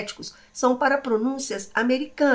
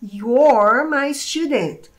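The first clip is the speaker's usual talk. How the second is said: slow demo; 5 dB louder, much slower than usual. Shown as a demonstration. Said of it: "Mas quando eu falo".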